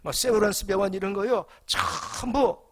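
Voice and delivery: loud, high, emphatic sermon delivery; rising fervent preacher's call; preaching at full force. Acted. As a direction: preach it out to the whole congregation. Preacher driving home his point.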